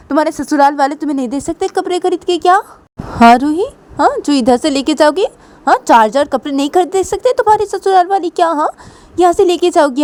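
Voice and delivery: high, loud scolding; mother scolding her daughter, loud and high; angry mother. Pretend. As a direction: throw her out of the house. Mother scolds Ruhi, throws her out.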